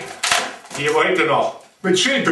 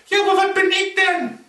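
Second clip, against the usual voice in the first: high snake voice; 10 semitones higher than usual. Acted. Snake asks.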